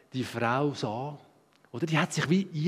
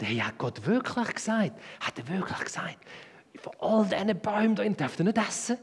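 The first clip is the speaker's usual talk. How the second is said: devil's question; 5 semitones higher than usual. Acted. Devil's tempting question voiced.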